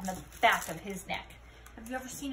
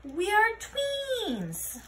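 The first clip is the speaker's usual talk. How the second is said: much higher and very slow; slow wonder; 9 semitones higher, much slower than usual. Acted. Scapito marveling at their matching faces. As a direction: draw it out in wonder.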